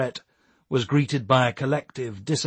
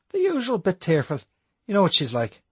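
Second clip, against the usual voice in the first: put-on young Irish lad voice; 7 semitones higher than usual. Matty's voice in story.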